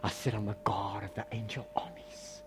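hushed, intense whisper; Jesus's declaration as a solemn whisper; dramatic whisper. Acted. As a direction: whisper it like a secret threat.

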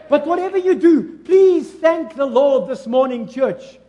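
loud, high shouted preaching; fiery preaching. Acted. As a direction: preach at full force to the church. Preaching at full force.

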